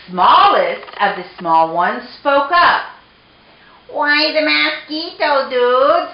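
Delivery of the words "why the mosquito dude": higher little sidekick voice; tiny Chihuahua. A narrator's reading. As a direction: ask as the smallest dog in the gang. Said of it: "asked Poquito Tito".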